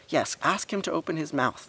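high-pitched insistent agreement; chorusing. A narrator's reading. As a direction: chime in like a band of children. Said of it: "the rest of the boys chorused".

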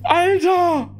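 shaken, high and breathless; rattled after a scare; scared horror game streamer. Real, not acted.

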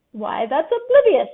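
chuckling. A narrator's reading. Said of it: "chuckled Tigger".

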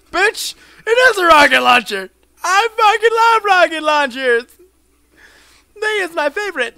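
high, very loud overexcited yelling; wild outburst. Real, not acted. Player discovering a rocket launcher, ecstatic high yelling. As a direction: shriek your love of the new weapon. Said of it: "I apologize for my outburst".

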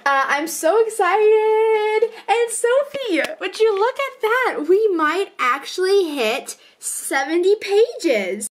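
high, slow, gushing; excited. Real, not acted. Author gushing to co-writer Sophie, high and excited. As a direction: beam about the page count. Excited about finishing book.

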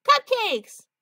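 high, loud, gleeful cry; excited squeal. Acted. Kiddo hearing of cupcakes in the van, excited squeal. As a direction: shout it like a kid spotting treats. Child lured by cupcakes, calls out.

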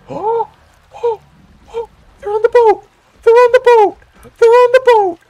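very high, loud squealing excitement; real ecstatic screaming; freaking out. Real, not acted. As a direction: shriek with joy in gasping bursts. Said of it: "I'm just freaking out right now".

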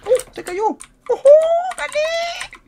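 very high loud victory shriek; real triumphant scream; ecstatic shriek. Real, not acted. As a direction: scream with joy as the enemy falls. Enemy falls, he cheers.

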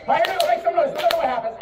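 usual speaking voice

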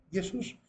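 "Jesús" said Yesús, with a Y; Greek pronunciation. Shown as a demonstration. Teacher repeating the name as said in Greek, Greek pronunciation. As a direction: open "Jesús" on a Y glide. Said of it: "en griego".

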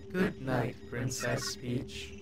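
slow, soft sinister goodnight; low villain voice. Acted. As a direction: murmur it like a schemer.

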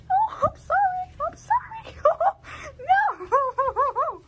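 high, loud laughing apology; laughing squeal. Real, not acted.

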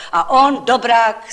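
usual speaking voice

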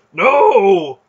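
very loud, high, agonized scream; hoarse cry. A narrator's reading. Burning man crying out in agony, hoarse. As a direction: cry out in horror and pain. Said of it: "he cried out hoarsely".

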